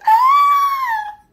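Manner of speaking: sudden shrill high yelp, loud; goblin scream. Retold or imitated. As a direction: let out a panicked little goblin shriek. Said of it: "even though I screamed like".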